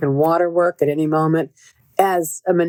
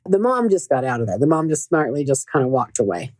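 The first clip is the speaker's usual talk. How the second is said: ordinary talk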